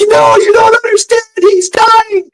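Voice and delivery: very high hysterical mock wail; hammed-up frantic protest, pitch sky-high; freaking out. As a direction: imitate a panicking bystander pleading desperately. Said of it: "she's freaking out".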